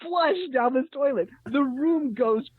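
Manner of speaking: high-pitched, cracking up through the story; laughing while telling. Real, not acted. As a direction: tell it on the edge of helpless laughter. Breaking up while telling a funny story.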